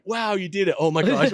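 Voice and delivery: high, loud rush of disbelief, half laughing; excited inner voice. Retold or imitated. Driving home after being offered presidency, excited exclaiming. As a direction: relive the giddy thrill of the news.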